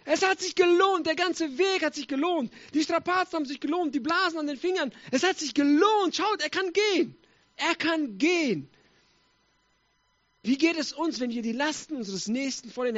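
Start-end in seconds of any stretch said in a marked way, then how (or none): 0.0-8.7 overjoyed. Acted. Friends seeing the healed man walk, jubilantly. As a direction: marvel and rejoice, stretching the words. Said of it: "riesig gefreut haben".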